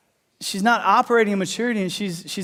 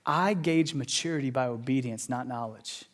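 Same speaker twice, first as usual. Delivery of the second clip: low, much softer, solemn; quiet voice of Jesus; 6 semitones lower, 9 dB quieter than usual. Retold or imitated. Jesus telling the church, low and soft. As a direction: speak it softly and weighty. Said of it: "Jesus comes along and goes".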